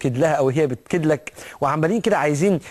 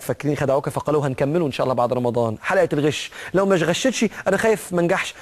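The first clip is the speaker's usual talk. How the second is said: ordinary delivery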